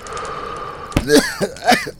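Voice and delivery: high, breathy startled cough; coughing fit. Acted. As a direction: cough as if knocked off balance. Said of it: "gegen den Kessel geschleudert". (gespieltes Husten)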